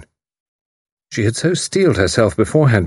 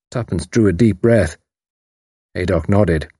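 ordinary delivery, nothing marked

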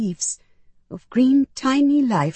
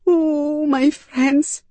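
high, drawn-out lament; anguished wail on the words; tearful grief; 10 semitones higher, 4 dB louder, much slower than usual. A narrator's reading. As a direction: mourn aloud, close to tears. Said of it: "tears welling in my eyes".

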